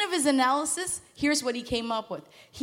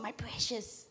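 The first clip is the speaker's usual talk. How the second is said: creepy Gollum-like whisper; 9 dB quieter, slower than usual. Retold or imitated. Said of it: "not so creepy".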